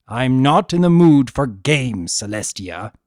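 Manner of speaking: loud, hard warning; loud and cold; angry. A narrator's reading. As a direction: snap it out in anger. Discord angry.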